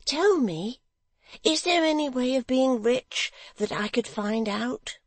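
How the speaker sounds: high, eager pleading question. Acted. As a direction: lean in, keen and hopeful. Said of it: "looking eagerly in his face".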